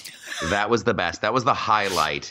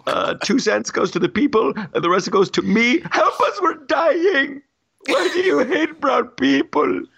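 King Julian voice; 12 semitones higher than usual. Retold or imitated. Host mocks mayor selling shirts, as high cartoon king. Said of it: "It's King Julian".

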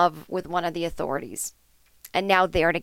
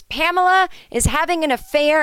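loud, high proclamation; a hammed-up outburst into the microphone; public announcement; 9 semitones higher, 4 dB louder than usual. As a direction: declare it to the whole room.

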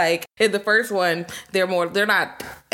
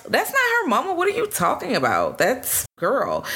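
high, incredulous squeal; incredulous denial; 8 semitones higher than usual. Retold or imitated. Islander brushing off the policeman, high and incredulous. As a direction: snap back in disbelief, pitched up. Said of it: "And it was like".

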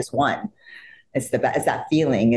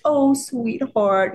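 tender sweetheart; 11 semitones higher, much slower than usual. Retold or imitated. Idol comforts her.

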